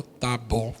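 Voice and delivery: low, soft resigned "tá bom"; quiet sulky okay; low mumbled okay. Retold or imitated. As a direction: mumble it, giving in. Resigned reply in story.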